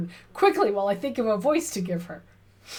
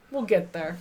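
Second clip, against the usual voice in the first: ordinary talk, nothing marked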